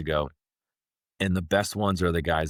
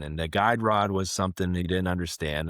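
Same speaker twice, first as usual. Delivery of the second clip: normal talking voice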